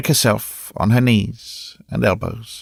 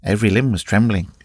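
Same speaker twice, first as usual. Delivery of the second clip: usual speaking voice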